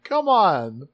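high-pitched, exaggerated appeal; mock pleading protest. Soldier reminds Megatron of the rescue, mock pleading. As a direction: plead your case, hammed-up.

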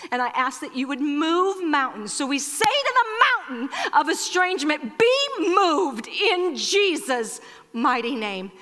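prayer building to a high, ringing command; forceful prayer declaration. Real, not acted. Pastor praying against family estrangement, building to forceful declaration. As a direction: declare it boldly, stretching the last phrase.

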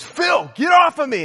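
high, loud mock-panicked scream; acting out a panicked yell; imitated freak-out. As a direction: shriek it like a terrified friend. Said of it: "Joel is really freaking out".

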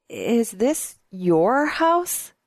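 slow question, halting and rising; hesitant disbelief. A narrator's reading. She learns he has a house, hesitant disbelief. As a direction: ask it slowly, hardly daring to believe. Stunned at being shown house.

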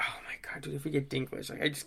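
breathless whispered oh my God; whispered dread. Real, not acted. Dreading a Dinklage casting.

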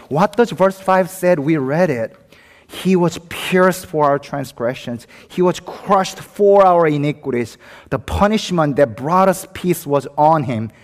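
forceful scripture recital; impassioned preaching. Real, not acted.

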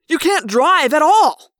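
loud, high, drawn-out shout; angry retort. A narrator's reading. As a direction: yell it back in exasperation. Quarrel with the driver.

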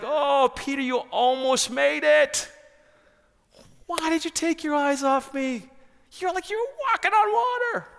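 very high, loud excited exclaiming; thrilled, not scolding. Acted. Jesus to Peter who almost walked on water, excited encouragement. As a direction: cheer on someone who almost made it, voice soaring. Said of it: "I don't think he was scolding Peter".

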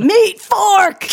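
loud, high shout. Acted. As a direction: yell it out. Shouting stage of the game.

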